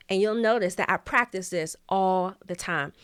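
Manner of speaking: usual speaking voice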